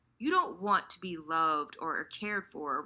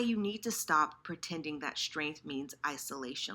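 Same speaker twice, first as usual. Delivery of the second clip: usual speaking voice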